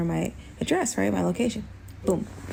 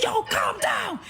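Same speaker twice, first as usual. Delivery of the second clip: high-pitched imitated shout; exasperated yell; slower than usual. Man ordering the panicking woman to settle, high shout. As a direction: re-enact yelling over someone's panic, pitch shot right up.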